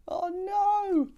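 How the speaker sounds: very high, loud drawn-out wail; exaggerated storybook dismay; mock horror. Reacts to bee being swallowed.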